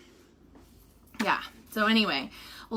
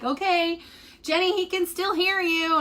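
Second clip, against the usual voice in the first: higher-pitched imitation of nurses; relaying nurses; 7 semitones higher than usual. Nurses relaying news over phone.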